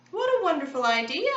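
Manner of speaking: high, loud delighted exclamation; exclaiming. A narrator's reading. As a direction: gush over the idea. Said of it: "Esme exclaimed".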